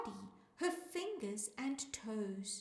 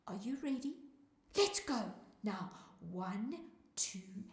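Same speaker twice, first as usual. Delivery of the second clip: plain reading voice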